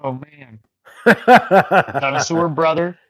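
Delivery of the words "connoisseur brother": drawn-out, laughing through the name, louder. Real, not acted. Laughing at a chat message.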